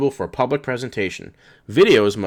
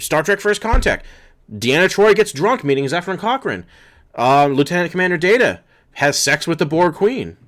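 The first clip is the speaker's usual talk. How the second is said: much higher, louder, pointed listing; indignant outburst; 8 semitones higher, 4 dB louder than usual. Real, not acted. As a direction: throw the examples back at them. Indignant listing of CBS hypocrisy.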